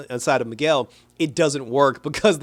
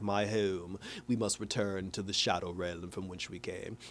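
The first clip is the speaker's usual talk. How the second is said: low ominous whisper-quiet; 7 semitones lower, 13 dB quieter than usual. Retold or imitated. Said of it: "he's like".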